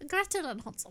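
laughing, hopeless stab at Irish; failed Irish accent. Retold or imitated. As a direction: copy an Irish accent badly. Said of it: "I can't do accents".

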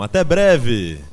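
high, loud call of goodbye; shouted farewell. A narrator's reading. Animals bid their friend farewell, shouting. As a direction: call out goodbye across the yard.

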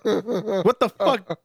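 high, slow, laughing disbelief. Real, not acted.

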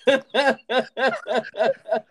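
high-pitched, breaking into tears of laughter; can barely get the words out for laughing; laughing hard. Real, not acted. Cracking up at prank call.

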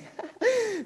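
short high burst of laughter. Real, not acted. Preacher laughing at the listeners' wrong conclusion. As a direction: laugh at the silly thought. Laughs at own mock thought.

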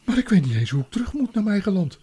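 put-on high, loud and quick young boy; anxious boy. Edmund asks the queen the way home, anxious and high. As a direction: blurt it out high and fast, stammering.

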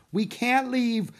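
loud, high, slow anguished cry; desperate cry. A narrator's reading. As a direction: cry it out in despair. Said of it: "cried Jack".

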